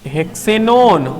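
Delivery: हेक्सेनोन drawn out slowly for the class; slow spelled-out name. Shown as a demonstration. Teacher to class, answering her own question, slow. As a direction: say हेक्सेनोन slowly, each syllable clear. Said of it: "तो क्या होगा".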